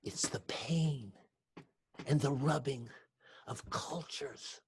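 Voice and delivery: slow unvoiced whisper; hushed whisper. A narrator's reading. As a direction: whisper it with weight. Narrator reflecting softly.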